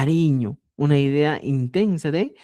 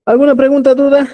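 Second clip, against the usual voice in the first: much higher, louder call to the class; 10 semitones higher, 10 dB louder, faster than usual. Real, not acted. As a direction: call out to the whole room. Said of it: "calling out to class". (alumnos, ¿alguna pregunta? gritando)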